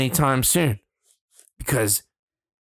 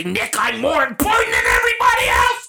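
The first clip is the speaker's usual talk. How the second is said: much higher, loud wailing mock shout; mock all-caps shout; 6 dB louder than usual. Mocking an all-caps coworker as a wailing shout. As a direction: ham up the demanding coworker. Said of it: "use all caps and say".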